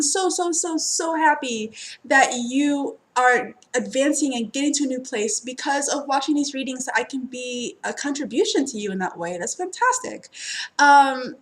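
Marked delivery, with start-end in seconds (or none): none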